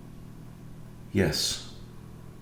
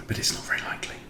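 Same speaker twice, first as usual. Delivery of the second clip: soft unvoiced whispered aside; whispering; 9 dB quieter, much faster than usual. Real, not acted. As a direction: drop to a whisper. Muttered aside about hopes.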